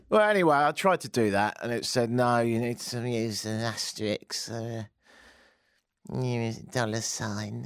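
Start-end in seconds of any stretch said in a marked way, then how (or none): 2.1-7.6 slow, drawn-out computer voice; talking computer. Retold or imitated. Computer rejecting a new password, slow put-on machine voice. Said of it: "that's how they should talk".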